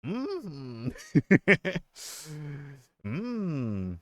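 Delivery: usual speaking voice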